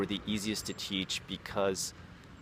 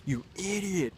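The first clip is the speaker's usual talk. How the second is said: mock shouting-down; 11 semitones higher, slower than usual. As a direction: pretend to berate someone in a high voice. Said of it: "shout people down".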